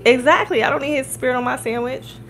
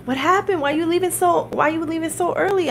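higher-pitched puzzled questions, retold; puzzled owner impression, pitched up; imitated concerned surprise; 9 semitones higher than usual.

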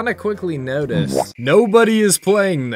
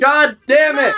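frustrated outburst; 10 semitones higher, 6 dB louder than usual. Real, not acted. Annoying kid keeps butting in.

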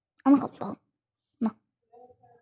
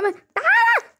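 shrill call. Pretend. Play fight, calling him back.

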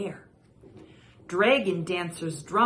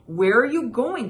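loud, high call after it; crying out; 4 semitones higher, 8 dB louder than usual. Acted. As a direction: shout it as it leaves. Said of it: "cried Mister Zhang".

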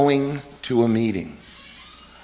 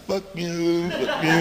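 high slurred sedated curse; mock drugged moan, words smeared; drugged, can't talk; 7 semitones higher, 3 dB quieter, slower than usual. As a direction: curse helplessly like a sedated slug. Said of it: "like, a slug".

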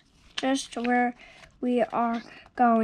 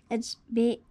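usual speaking voice

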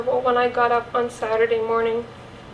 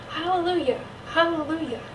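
higher, restrained call of joy; distant joyful shout; 4 semitones higher, faster than usual. A narrator's reading. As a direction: call out hallelujah as if faintly heard far off. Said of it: "we heard women's voices shouting".

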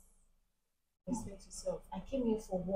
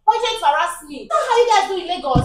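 heated, raised-voice protest; indignant refusal; 4 semitones higher, 4 dB louder than usual. Acted. Barber rejecting client's money, heated and indignant.

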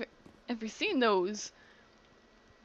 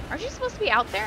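higher, a bit louder, surprised; a puzzled classmate catching her; someone pointing it out; 10 semitones higher, 5 dB louder, much faster than usual. Retold or imitated. A classmate finds her stuck backstage, puzzled and higher. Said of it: "until someone's like".

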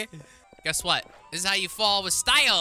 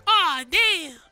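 pitched-up, cocky street-dog voice; 8 semitones higher, 3 dB louder than usual. Pretend. Tyrone the dog sasses his owner in a higher, cocky voice. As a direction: talk back like a cheeky streetwise dog.

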